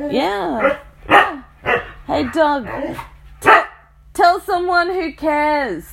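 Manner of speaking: high, loud dismissive jeer; loud scorn at the whining dog; scornful yell. Real, not acted. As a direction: tell the dog nobody cares. Scolding the dog.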